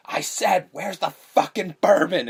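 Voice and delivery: imitated man losing patience, stretching the words; angry repeated demand. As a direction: hammer out the demand, slow and loud.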